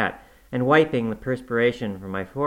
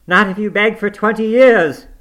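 loud, higher refusing cry; angry shout; 10 semitones higher, 11 dB louder than usual. Acted. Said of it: "I shouted".

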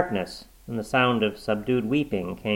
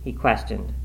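plain reading voice

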